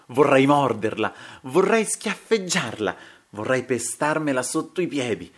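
loud, high, furious outburst; hateful outburst. Acted. Duellist raging about the woman he hates, furious. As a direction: hurl out each wish with rising hatred. Acted outburst of hatred for her.

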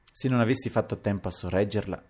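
usual speaking voice

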